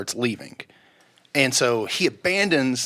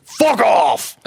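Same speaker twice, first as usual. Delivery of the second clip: loud, raised mock-angry shout; angry brush-off; 8 semitones higher, 6 dB louder than usual. Mel Gibson waving away a fan without turning, angry dismissive shout. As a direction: imitate a star yelling a pest away.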